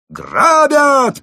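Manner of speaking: extremely high, loud holler; gleeful, unbroken top-of-the-voice scream; roaring yell. A narrator's reading. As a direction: belt it out at full volume. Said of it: "проорал злоумышленник".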